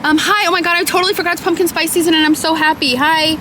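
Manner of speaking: high, loud and gushing with delight; overjoyed greeting at the drive-thru, high and loud; excited. Real, not acted. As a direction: burst out with joy at the drive-thru.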